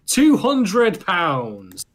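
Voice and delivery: slow, loud and high "two hundred pounds"; shocked disbelief. Real, not acted. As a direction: land the price in disbelief. Shocked price reveal.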